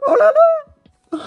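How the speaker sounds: piercingly high squeal into giddy laughter; ecstatic disbelief. Real, not acted. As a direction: squeal as if you cannot believe your luck. Overjoyed at a lucky pull.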